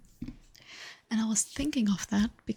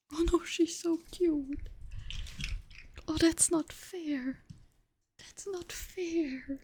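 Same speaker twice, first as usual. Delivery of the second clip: cooing at the cat; 7 semitones higher, slower than usual. Real, not acted. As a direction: melt over how cute she is. Cooing over the cute cat.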